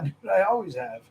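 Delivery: usual speaking voice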